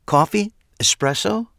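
high, lilting offer; bright hostess voice. A narrator's reading. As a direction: lift it up like a hostess. Trish offering drinks.

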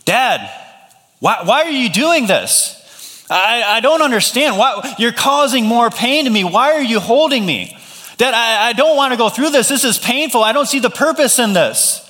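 high-pitched mock wail of a hurt child; imitating screaming son. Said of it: "and he's screaming".

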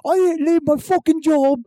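high, sneering Cockney rant; mock Cockney accent. Parody British contestant cursing Thatcher, mock Cockney accent. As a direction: spit insults like a drunk Brit. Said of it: "Cockney accent".

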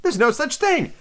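put-on high girlish objection; mildly acted anger; very angry. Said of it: "began very angrily".

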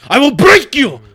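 loud, high hammed-up threat yell; loud high joking menace; mock threat. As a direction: bellow it like a cartoon villain.

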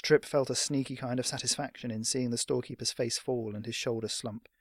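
plain reading voice

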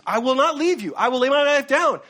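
loud, high-pitched pledge; bold vow. Acted. Voicing Peter's bold vow to Jesus.

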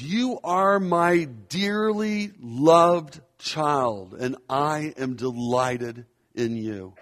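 slow proclamation. Acted. God's words proclaimed to listeners.

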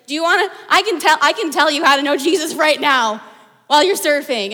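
loud, insistent preacher's plea; emphatic pitch. Retold or imitated. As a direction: press him hard, half joking. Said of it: "And I was like".

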